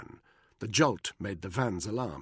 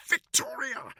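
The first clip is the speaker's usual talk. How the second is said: very high desperate cry; high-pitched, desperate; slower than usual. A narrator's reading. Buckingham throttled, blurting a name in panic. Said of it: "he said, high-pitched, desperate".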